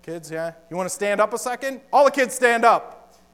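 ordinary talk, nothing marked

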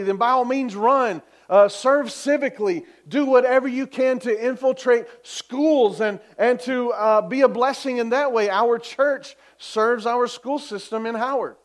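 rally the church with growing fire; fervent preaching. Real, not acted. Preaching at full force.

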